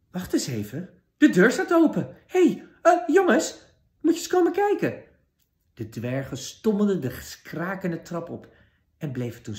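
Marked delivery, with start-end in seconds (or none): 0.1-5.0 much higher, louder little dwarf voice, speeding up; excited dwarf. Acted. Dwarf calls the others to the open door, excited and high. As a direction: squeak out the alarm like a startled little dwarf.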